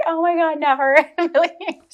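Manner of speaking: high, laughing mock horror; giggling no way; laughing through a retold no; laughing refusal. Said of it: "I'm like".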